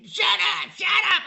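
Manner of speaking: high, loud shouting like a spoiled brat; bratty tantrum. Retold or imitated. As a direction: throw a tantrum like a child. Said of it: "like a brat having a temper tantrum".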